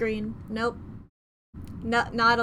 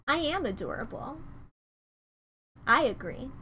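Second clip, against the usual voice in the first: ordinary delivery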